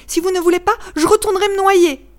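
put-on child pleading with a stranger. A narrator's reading. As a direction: beg him like a desperate little boy. Child insists, threatening to drown.

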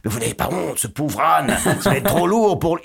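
building to a high, loud outcry; outraged passerby. Retold or imitated. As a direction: protest on the donkey's behalf. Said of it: "il rencontre quelqu'un d'autre qui fait".

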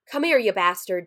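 loud, narrated yell; angry yell. A narrator's reading. Man chasing an intruder with a poker, yelling. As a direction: yell it after someone running away. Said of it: "Jacob yelled".

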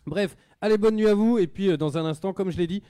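radio host loudly signing off to the listeners. Real, not acted. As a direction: call out the goodbye to the listeners.

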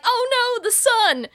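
high, loud panicked cry; alarmed cry. Retold or imitated. Mark cries out in panic as the filter blasts sunlight, high alarmed cry.

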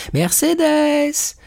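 a high, drawn-out happy call from outside; joyful call. A narrator's reading. Edmond calling Mercédès joyfully from outside the house. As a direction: call her name joyfully from afar. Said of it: "cria une voix joyeuse au dehors".

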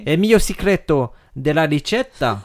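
slow hammy fake Italian; fake Italian accent. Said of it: "tu parles très bien le faux italien".

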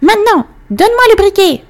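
put-on high greedy old witch; shrill demanding crone. A narrator's reading. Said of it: "la vieille dame le regarda avec avidité".

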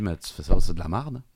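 lightly imitated Québécois accent. Host quotes a Québécois interviewer, light mock accent. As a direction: retell it with a touch of Québécois. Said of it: "de manière pourrie, hein, l'accent".

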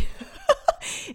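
a burst of high real laughter; short laugh. Real, not acted. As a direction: laugh at yourself, amused. Laughs at herself. (petit rire spontané et aigu)

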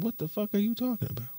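retold disbelief; shocked. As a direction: blurt it out fast. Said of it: "I said it just like that".

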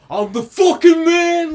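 very high, very loud slow scream; imitated scream. Imitating Diggs screaming in the end zone, wild scream. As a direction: scream it like a player celebrating. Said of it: "screaming in the end zone".

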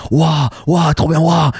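high, gushing wows; excited wows. Retold or imitated. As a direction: gush like a fan at the front row. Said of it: "j'étais genre".

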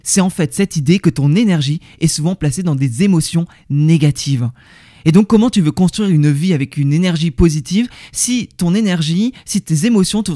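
usual speaking voice

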